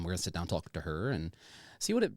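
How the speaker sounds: ordinary talk, nothing marked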